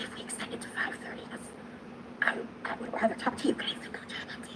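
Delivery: whispered, voiceless aside. Real, not acted. Streamer whispering an aside to her chat, hushed. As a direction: breathe it out under the breath.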